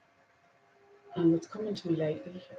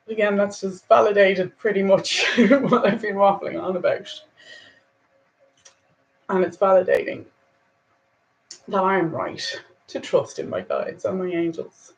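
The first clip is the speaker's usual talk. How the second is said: emotional; 7 dB louder than usual. Real, not acted. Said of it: "I'm feeling a little emotional".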